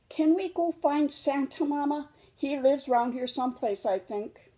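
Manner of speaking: a small cub pleading to go find Santa; eager little cub. Acted. Little cub in Mama's lap asks to find Santa, eager.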